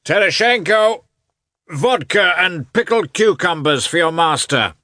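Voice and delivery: loud, high, ringing call to a servant; shouted order. A narrator's reading. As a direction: bellow the order, relishing your own voice. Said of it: "he shouted, evidently enjoying".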